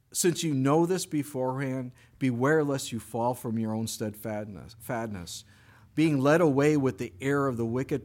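measured, solemn reading of the verses; scripture reading. Acted. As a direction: read it like Scripture before the flock. Reads scripture passage aloud.

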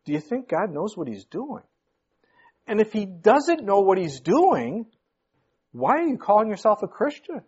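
high, indignant fiery preacher; preaching. Acted. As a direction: challenge the congregation hard. Preaching at full force.

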